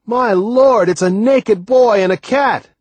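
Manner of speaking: loud, high astonished yell. A narrator's reading. Sailor reports naked boy to the boat, yelling. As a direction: shout the discovery back to the boat. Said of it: "he yelled to someone on the boat".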